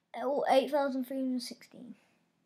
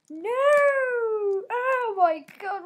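panicked wail; 10 semitones higher, 10 dB louder, much slower than usual. Real, not acted. Panics as he is trapped.

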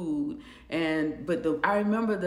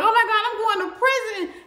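high, loud, laughing panic; hammed-up dread of prison; mock panic; 12 semitones higher, 7 dB louder than usual. Retelling her panic to viewers, laughing high alarm. Told of transport to facility.

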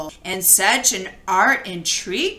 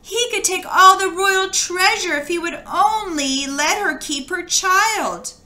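pleading queen; 8 semitones higher than usual. A narrator's reading. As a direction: beg for the child, pitched up. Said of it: "The queen pleaded".

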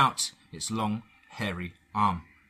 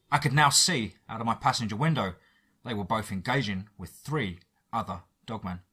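ordinary delivery, nothing marked

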